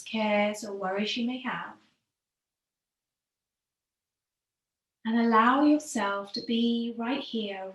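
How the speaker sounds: calm yoga teacher easing the class into stillness; guided meditation. Acted. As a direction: guide them gently into the breath, letting phrases sink at the end. Leads breathing meditation.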